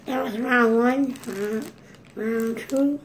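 cheeks stuffed full. Real, not acted. Talking with mouth stuffed full.

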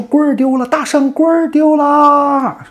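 very high, loud drawn-out holler; hammed-up high town-crier shout; crowd crying out. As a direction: spread the news at full yell, stretching the end. Growing crowd spreads the news.

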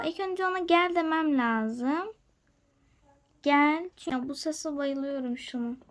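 usual speaking voice

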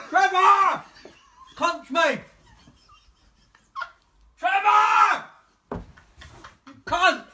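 fed-up man bellowing at the smart speaker; angry shout. Real, not acted. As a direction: roar at the gadget. Yelling at unresponsive speaker.

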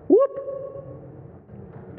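a squeaky, high, breathless whoop; comic high-pitched whoop; high whoop sound effect. Acted. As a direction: make the sound of the knife slipping, high and quick. Knife slipping, acted sound.

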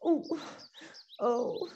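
ordinary delivery

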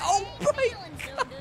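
high, squeaky through helpless laughter; laughing. Real, not acted. Streamer losing it over the crash game, laughing hard. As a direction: gasp it out while cracking up.

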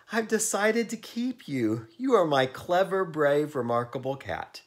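high, loud, delighted declaration; Miss Perkins calling out. A narrator's reading. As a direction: announce it high and loud, easing down. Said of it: "called Miss Perkins".

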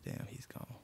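unvoiced whisper; hiding in the room, whispering to himself. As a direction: breathe it with no voice. Whispering behind door.

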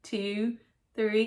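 normal talking voice

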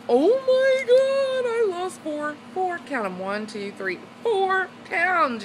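high, slow, drawn-out squeal of joy; thrilled counting of pounds lost; giddy excitement. Real, not acted. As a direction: savour every word of good news. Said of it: "I'm so stinking happy".

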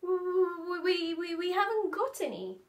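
Daddy stammering to the inspector. A narrator's reading. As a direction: stumble over the words in fear. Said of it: "stammered Daddy Elephant".

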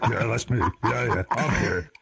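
slightly low monster voice; lightly voiced gruff alien answering; Judoon roll-call reply.

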